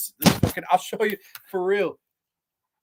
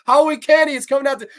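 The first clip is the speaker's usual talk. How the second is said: mock panic; 11 semitones higher, 9 dB louder, faster than usual. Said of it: "I'm like".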